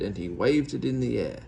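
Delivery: plain reading voice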